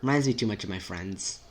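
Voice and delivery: ordinary talk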